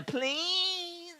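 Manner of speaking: high, drawn-out mock plea; mock pleading. Streamer reading a viewer's impression request, high mock begging. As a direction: stretch it out high like a begging fan.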